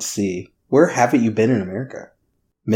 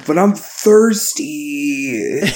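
drawn-out, higher whine; mock complaining; 4 semitones higher, much slower than usual. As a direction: whine it slowly like a put-on sulky complainer. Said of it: "hear me complain the whole time".

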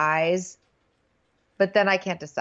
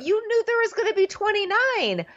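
high-pitched exasperated outburst; incredulous and way up in pitch; mock outrage; 12 semitones higher than usual. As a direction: exclaim in high, comic disbelief. Mock outburst at a contestant's quote.

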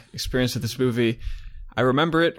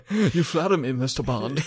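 Bond villain; 2 semitones higher than usual. Retold or imitated. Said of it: "kinda like a Bond villain or something".

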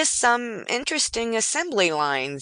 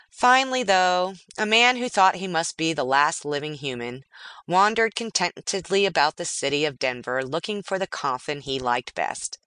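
ordinary delivery

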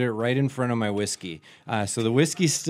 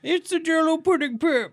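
much higher, cartoonish put-on pitchman; Cosby-style ad voice. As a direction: ham up an old pudding commercial. Mocking a Bill Cosby ad line.

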